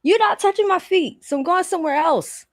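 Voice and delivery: begging the tech to ease off; exasperated outburst. Retold or imitated. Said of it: "I was like".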